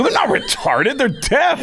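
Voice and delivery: high-pitched, protesting retort; indignant correction. Retold or imitated. Comedian correcting the drummer about his family, high indignant retort.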